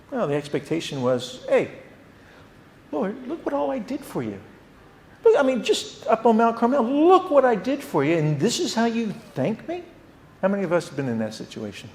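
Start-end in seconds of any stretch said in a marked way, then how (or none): 1.5-9.8 high, loud aggrieved whine; indignant complaint. Retold or imitated. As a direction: demand credit like a wronged servant. Elijah complaining to God.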